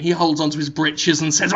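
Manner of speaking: usual speaking voice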